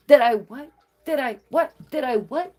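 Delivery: high, rising disbelief; incredulous comeback. Retold or imitated. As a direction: throw the question back, pitch climbing. Retold shocked comeback to insult.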